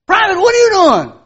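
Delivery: a loud, high officer's shout; lieutenant yelling at the dozing sentry; barking lieutenant. Retold or imitated. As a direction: startle the sleeping guard awake. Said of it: "lieutenant ... said".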